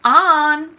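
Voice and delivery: grandfather loudly calls On to dinner; calling out. A narrator's reading. Grandfather calling On to dinner, loud call. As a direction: call across the house, loud. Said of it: "grandfather called".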